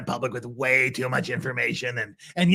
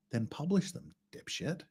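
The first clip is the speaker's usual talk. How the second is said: muttered; 2 semitones higher, 13 dB quieter than usual. Real, not acted. Muttered retort to quote.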